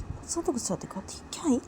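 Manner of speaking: hushed fast whisper to herself; whispered mutter. Acted. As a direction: whisper it to herself under her breath. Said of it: "अपने आप बोलती कि".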